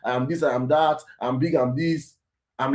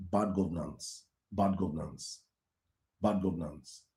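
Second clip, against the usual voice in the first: low grave repetition; 8 semitones lower, 12 dB quieter than usual. Real, not acted. Repeated low verdict.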